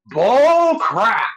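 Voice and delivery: loud high mock shout; hammed-up angry cry; mock outburst. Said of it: "can you quote the scene".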